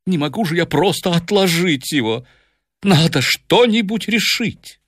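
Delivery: high, desperate pleading; panicked professor voice; frightened. A narrator's reading. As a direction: beg urgently for a solution. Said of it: "Профессор был поражён и напуган".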